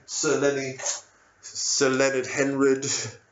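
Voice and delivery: usual speaking voice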